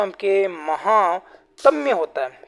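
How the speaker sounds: महात्म्य drawn out slowly, teacher stressing it; slow correct form. Shown as a demonstration. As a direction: spell out महात्म्य slowly for the class. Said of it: "महात्म्य नहीं होता".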